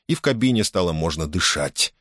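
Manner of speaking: ordinary delivery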